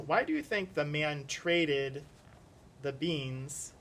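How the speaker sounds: usual speaking voice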